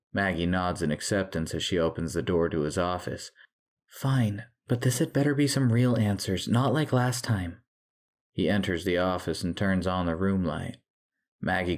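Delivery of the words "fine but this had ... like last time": higher, exasperated agreement; grudging warning. A narrator's reading. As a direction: give in but warn him. Wife grudgingly agrees, warns husband.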